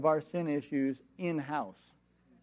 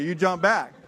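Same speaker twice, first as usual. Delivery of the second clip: ordinary talk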